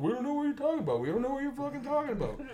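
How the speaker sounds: high, fast squeaky denial; mock kid denial. Kids denying to the driver, high fast denial.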